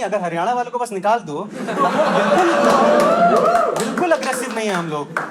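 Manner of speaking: ordinary talk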